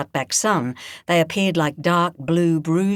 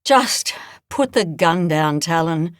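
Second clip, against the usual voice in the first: sighed. Acted. Edna wearily asking Talon to drop the rifle, sighing. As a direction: let it out on a tired breath. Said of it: "Edna sighed".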